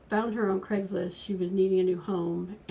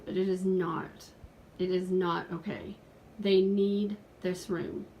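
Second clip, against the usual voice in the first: ordinary delivery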